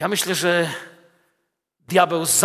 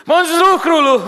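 cheerful greeting; 9 semitones higher, 8 dB louder, slower than usual. Acted. Daniel calls up from the lions' den, loud and high. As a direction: shout up the good news from the pit.

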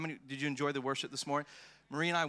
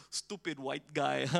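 laughing mockery; slower than usual. Mocking bystanders laughing.

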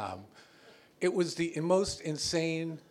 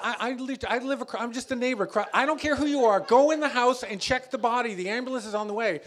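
frantic to operator; 8 semitones higher, 7 dB louder, faster than usual. Retold or imitated. Frantic argument with 911 operator.

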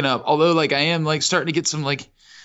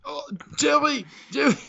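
sprayed with water; 9 semitones higher than usual. Pretend. Pretends chicken shit in mouth.

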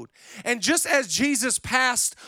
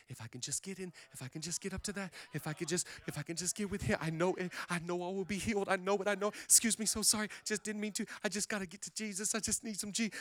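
murmured determined mutter; 13 dB quieter, faster than usual. Retold or imitated. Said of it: "she just goes".

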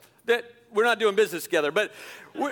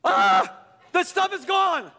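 alarmed; 6 semitones higher, slower than usual. Retold or imitated. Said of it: "I'm like".

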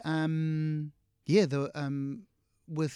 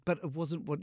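ordinary talk

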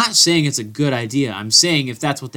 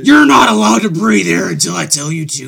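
louder, higher mock drill-sergeant order; bossy manager shout; 5 semitones higher, 6 dB louder than usual. Mock sports manager shouting orders at the players. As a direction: bark it like a tyrant boss guarding his assets. Playing a strict team manager.

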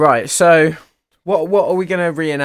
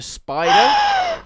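shocked shriek. Acted. Skit, finding spider.

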